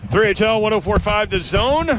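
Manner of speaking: station ID. Acted. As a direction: call out the station like a radio host. Radio show opening station ID.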